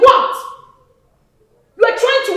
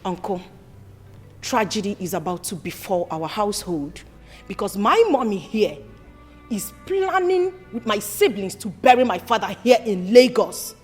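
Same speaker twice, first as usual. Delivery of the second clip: much lower, soft complaint; low earnest plea; 10 semitones lower, 6 dB quieter than usual. Acted. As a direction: confide the betrayal to uncle. Daughter pleading quietly to uncle.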